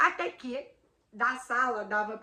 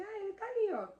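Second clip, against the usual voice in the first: offhand, higher and breezy; casual; 12 semitones higher than usual. Retold or imitated. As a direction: point it out like it's nothing. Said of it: "Como se tivesse falando assim".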